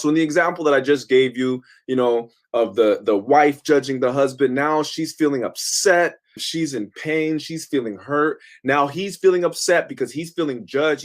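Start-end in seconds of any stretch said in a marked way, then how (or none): none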